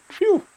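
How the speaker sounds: relieved exhale. Acted. Executioner finishing the swing.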